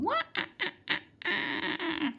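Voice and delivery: high, wailing screech with stuttered ah-ah-ahs; mock decoration screech. Mimicking the neighbour's Halloween decoration to viewers, high screeching wail. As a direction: mimic the spooky decoration going off. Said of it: "it was screeching".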